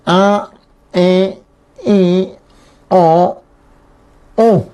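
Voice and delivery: "A, E, I, O, U" held and pushed through the nose; slow nasal vowel drill, one vowel at a time. Shown as a demonstration. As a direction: demo each nasal vowel for the class. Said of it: "Así suena más o menos".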